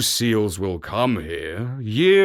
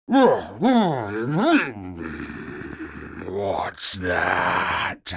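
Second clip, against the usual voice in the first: groggy walrus; 3 semitones lower than usual. A narrator's reading. Sleepy walrus woken.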